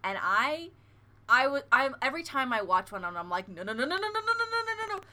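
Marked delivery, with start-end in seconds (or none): 3.5-5.0 rapid-fire refusals climbing high; mock panic. Acting out watching an ASMR video, mock panic. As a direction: let the nos run together, rising in mock alarm.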